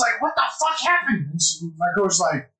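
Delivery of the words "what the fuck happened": imitated alarmed mom. Mom alarmed at injured son.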